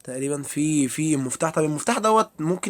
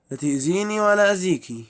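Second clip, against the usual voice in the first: higher and louder, half-joking plea to the ghost; scared plea; 5 semitones higher, 7 dB louder than usual. Real, not acted. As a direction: beg the ghost to leave you alone. Pleading with the ghost. (رجاء خايف ونص هزار بصوت أعلى)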